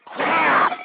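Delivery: high, loud tirade breaking into a raw scream; explode, straining on the insults; enraged screaming. Real, not acted. Host cursing listeners who do nothing, screaming rage. Rant boils over into screaming.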